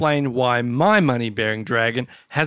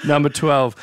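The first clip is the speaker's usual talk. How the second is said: ordinary talk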